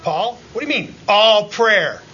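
normal talking voice

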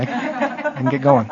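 high, slow, laughing jab at himself; laughing self-tease. Real, not acted. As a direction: chuckle it out as a joke about his own fire. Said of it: "I know I'm not as fiery as Sean".

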